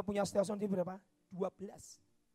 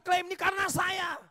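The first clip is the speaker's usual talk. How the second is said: loud, high proud claim; hammed-up boastful shout; mock boast; 8 semitones higher, 6 dB louder than usual. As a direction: shout it like a show-off. Boastful quote of proud prophet.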